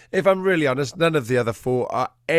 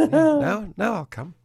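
much higher, breezy voice for the dog; dog's cheerful reply; 11 semitones higher, 4 dB louder, slower than usual. Pretend. Dog Peanut agreeing to come once the owner calmed, lightly voiced and breezy.

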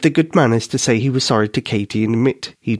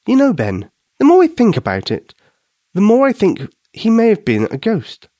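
lightly voiced young girl, a little awed; a girl half-spooked by her own idea; Katie's voice; 10 semitones higher than usual. A narrator's reading. Narrator voicing Katie telling Ben about the ghost, lightly higher.

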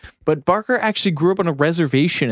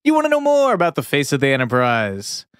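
ordinary talk

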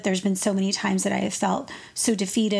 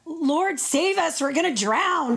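mock panic; 6 semitones higher, slower than usual. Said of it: "they say".

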